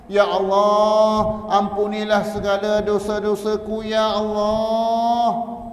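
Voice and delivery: high, loud, drawn-out cry to God, very slow; wailing plea. Shown as a demonstration. Preacher demonstrates how to beg God for forgiveness, wailing plea. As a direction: stretch each Ya Allah into a long, high lament. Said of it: "merayu, merintih kepada Allah".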